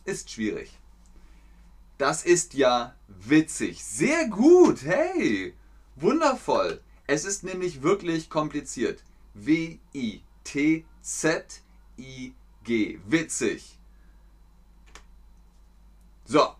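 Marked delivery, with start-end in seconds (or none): 3.8-6.8 high, loud, delighted; excited praise. Real, not acted. Teacher cheering on the chat, delighted. 9.3-13.0 slow letter-by-letter spelling; spelled out. Shown as a demonstration. Teacher slowly spelling out witzig for learners.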